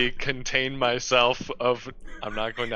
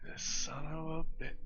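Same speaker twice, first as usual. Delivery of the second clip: much softer grumble under the breath; muttered curse; 3 semitones higher, 14 dB quieter than usual. Real, not acted. [name] grumbling at the Doc, soft muttered curse. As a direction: curse quietly to yourself.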